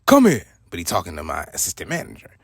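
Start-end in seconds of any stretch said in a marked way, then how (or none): 0.1-0.5 higher and louder, calling him over; a hard summons across the road; commanding call. Retold or imitated. The big boss calling over the assistant manager, loud command. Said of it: "And he said".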